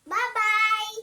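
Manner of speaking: high, loud sing-song bye-bye; calling goodbye. Pretend. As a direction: call it out brightly. Saying goodbye across distance. (pasigaw na paalam)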